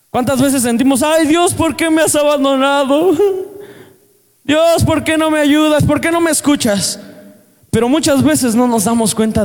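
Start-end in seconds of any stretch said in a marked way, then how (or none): 1.0-7.0 imitating a believer who feels God has abandoned them; despairing plea. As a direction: wail it out like someone feeling abandoned.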